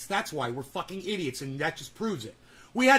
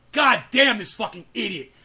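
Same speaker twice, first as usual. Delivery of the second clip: ordinary talk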